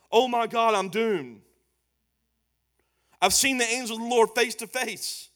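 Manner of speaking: loud, high panicked cry of doom; panicked dread. Acted. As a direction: blurt it out as if sure you're about to die. Gideon realizes he saw God.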